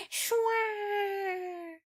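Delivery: high, soft, long "shwa" glide; smooth scooping sound. Shown as a demonstration. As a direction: imitate the scoop gliding through, softly fading. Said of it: "it goes like".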